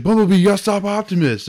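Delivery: lightly acted movie plea; mock urgent hero. Host retelling the hero begging Bumblebee, hammed-up urgency. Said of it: "he's like".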